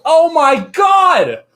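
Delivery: long, loud, high shriek of surprise; shocked shout. Retold or imitated. Children spot a fursuit and yell, loud and drawn out. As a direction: stretch it out like kids yelling from far off.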